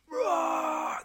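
high, distant-sounding crowd roar; long held roar of a screaming crowd; imitated crowd scream. Crowd reacting to the replay, imitated high roar. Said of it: "they're screaming".

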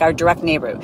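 ordinary talk

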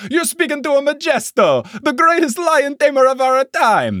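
high, booming cartoon Italian accent; cartoonish Italian. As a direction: proclaim it like an over-the-top Italian showman. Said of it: "cartoonishly Italian".

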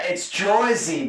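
Joisey with a thick New Jersey oi; New Jersey accent. Shown as a demonstration. Guest correcting the hosts, in a thick New Jersey accent. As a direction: drawl out Joisey like a true New Jersey local. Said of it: "no one says it like that".

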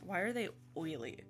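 ordinary delivery

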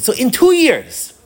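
"two years" drawn out, high and loud; emphatic point-making. Retold or imitated. Rebbe makes his point to student.

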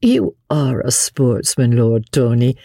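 plain reading voice

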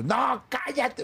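high, drawn-out mock scolding; angry cut-off. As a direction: imitate an irritated boss silencing someone. Boss cuts off an excuse, acted. (regaño imitado, agudo y alargado)

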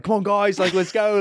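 high imitation of an Australian cheerleading teammate; rally the team in a put-on Australian accent. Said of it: "yelling in his Australian accent".

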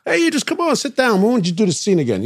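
high, fast, eager producer voice; high excited producer. Retold or imitated. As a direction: play the producer keen to start. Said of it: "producer voiced in story".

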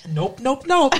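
lightly imitating a cartoon dinosaur. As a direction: bounce the nopes like a kids' cartoon dinosaur. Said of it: "Suddenly you were a character from The Land Before Time".

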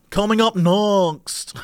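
"Coming up next" slow, with long stretched O vowels; British announcer drawl; drawn-out O sounds. Shown as a demonstration. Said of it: "really draw out your O sounds".

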